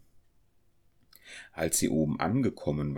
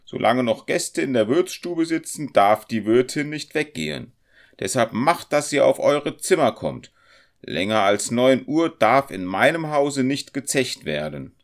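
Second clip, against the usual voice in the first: high, loud scolding; irritated landlady in a raised voice; annoyed; 8 semitones higher, 8 dB louder than usual. A narrator's reading. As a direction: lay down the law crossly. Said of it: "entgegnete sie ärgerlich".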